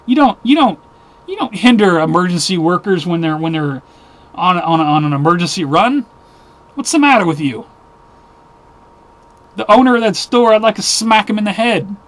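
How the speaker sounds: loud, high, angry outburst at the boot owner; furious and raising the voice; worked-up rant. Real, not acted. To viewers, outraged about the booted ambulance, angry shout. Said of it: "Sorry I got a little worked up".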